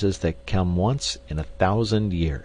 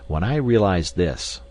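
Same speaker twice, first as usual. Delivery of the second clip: ordinary delivery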